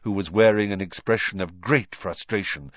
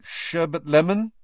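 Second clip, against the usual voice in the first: higher, questioning guess; tentative; 8 semitones higher, 4 dB louder than usual. A narrator's reading. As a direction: try it out unsurely. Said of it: "he tried, tentatively".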